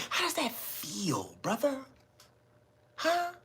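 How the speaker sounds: fading to a soft hushed whisper; taunting whisper. Retold or imitated. Comedian taunting the mayor, fading hushed whisper.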